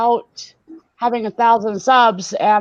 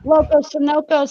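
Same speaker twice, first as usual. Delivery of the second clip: usual speaking voice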